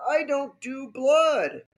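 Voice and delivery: high, slow protest; drawn-out, high-pitched refusal; freaking out. Retold or imitated. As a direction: imitate a squeamish nurse. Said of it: "my nurse started to freak out".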